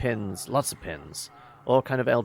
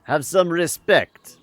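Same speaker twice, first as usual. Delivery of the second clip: slow, loud and high; slowly and hard, demanding respect; a sharp rebuke, loud and slow; stern demand; 8 semitones higher, 10 dB louder, slower than usual. Acted. Rude demand in story.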